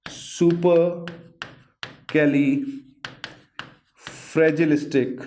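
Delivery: very slow, broken into chunks; spelling out a long word for students, very slowly; syllable by syllable. Shown as a demonstration. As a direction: sound out सुपरकैलीफ्रेजिलिस्टिक piece by piece. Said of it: "ये क्या है".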